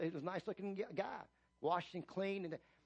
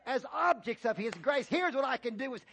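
louder and higher, building sermon cadence; full-force preaching; 6 semitones higher, 6 dB louder than usual. Real, not acted. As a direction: preach it out, stretching key words.

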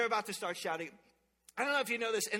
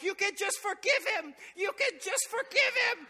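put-on crying child plea; 10 semitones higher, 4 dB louder than usual. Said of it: "he's crying, and he's like".

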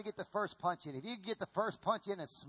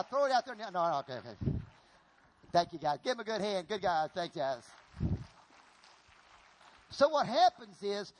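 ordinary talk

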